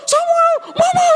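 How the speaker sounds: drawn-out falsetto shouts, acted; calling to each other. Mother and lost son calling each other, acted falsetto shouts. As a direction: trade high shouts as mother and child.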